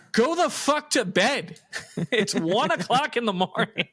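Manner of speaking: a fed-up father ordering bed; mock angry dad. Dad sending his scared teen to bed, hammed-up exasperated yell. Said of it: "he goes".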